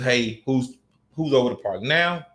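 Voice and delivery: ordinary talk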